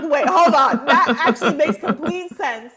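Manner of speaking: high, loud, laughing through the words; laughing realization. Retold or imitated. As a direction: laugh as it suddenly clicks. Sudden realization at healer's words.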